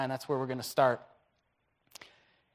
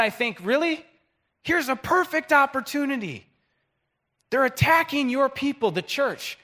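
higher, louder fiery preaching; preaching at full force; 6 semitones higher, 6 dB louder than usual. Acted. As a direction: thunder about God's fire from heaven. Preaching builds to fire.

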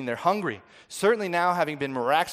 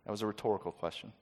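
dry aside; 6 semitones lower, 10 dB quieter, faster than usual. Real, not acted. Dry aside after the quote.

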